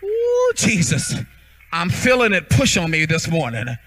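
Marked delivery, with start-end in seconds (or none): 0.0-1.1 Jesus cried out much higher and slow; a sudden high cry of rapture; ecstatic cry. Real, not acted. Preacher crying out to Jesus, moved.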